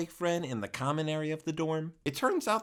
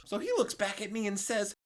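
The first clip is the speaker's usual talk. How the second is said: ordinary delivery, nothing marked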